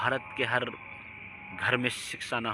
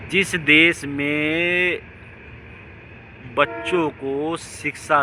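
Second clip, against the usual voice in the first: dramatic slow emphasis; 5 semitones higher, 6 dB louder, much slower than usual. Real, not acted. Slow dramatic opening of a point.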